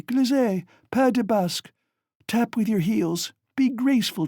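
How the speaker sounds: high, rushing delirious chatter; delirious mother recalling a dance and song; feverish excitement. A narrator's reading. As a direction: rave happily in fever. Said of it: "She was violently excited".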